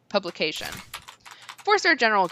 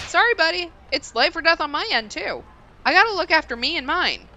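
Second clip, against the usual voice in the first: firm refusal; 10 semitones higher, 3 dB louder than usual. Acted. Refusing the desperate man.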